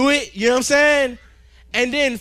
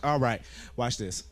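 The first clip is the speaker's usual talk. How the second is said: normal talking voice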